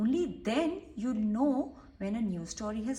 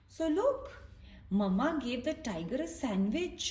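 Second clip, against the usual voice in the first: ordinary delivery, nothing marked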